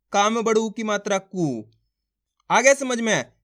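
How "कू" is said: कू with a long, held oo; long vowel. Shown as a demonstration. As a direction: stretch out the oo of कू. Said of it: "खींच खींच के इसका उच्चारण".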